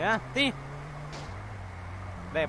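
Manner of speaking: ordinary talk, nothing marked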